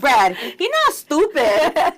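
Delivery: loud, high, laughing drawl; laughing tease. Real, not acted. Friend teasing the storyteller's husband, laughing hard. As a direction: crow it slowly through real laughter.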